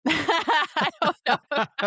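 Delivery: slow, laughing it out. Real, not acted. Laughs at own past reaction.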